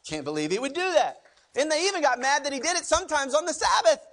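ordinary talk

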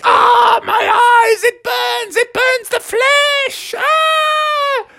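very high screamed wail; agonized mother frog. Acted. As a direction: shriek in burning pain, last words stretched. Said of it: "Mother was like".

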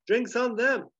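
high, loud bar call; calling out an order. Acted. Dusty orders from the bartender on his friends, calling out. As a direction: announce it to the whole bar.

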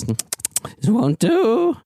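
high, very slow disdainful drawl; the sorceress frowns as her victim resists; disappointed sorceress. Acted. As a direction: drag it out, coolly displeased. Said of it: "she says".

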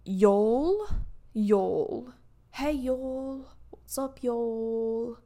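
trying out a British "y'all"; a light, tentative British accent on each greeting; playful stab at sounding British; attempted British accent. Shown as a demonstration. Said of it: "would British people say it like".

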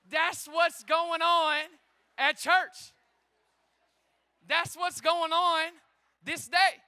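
slow, loud, drawn-out proclamation; slow booming preaching. Acted. Pastor proclaiming to the congregation, slow booming preach. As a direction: lean on every word.

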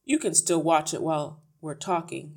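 a hint of loud impatience; gruff husband. A narrator's reading. Husband answers his protesting wife, gruff.

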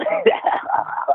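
high, shrieking imitation witch cackle; hammed-up witch screech-laugh. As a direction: cackle like a mad castle witch. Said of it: "there's a witch that runs around the castle going".

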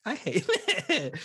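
mock outrage that dissolves in laughter; laughing complaint. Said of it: "I was like".

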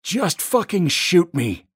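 loud, high drawn-out scream; high-pitched, full-force shout; desperate yell. A narrator's reading. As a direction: yell it out slowly at the top of your voice. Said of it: "I yell".

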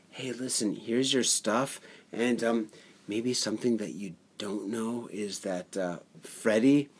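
low, hushed voice sinking into a whisper; subtle confiding. Acted. Co-host acting a subtler prank on her, hushed confiding. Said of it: "a little bit more subtle".

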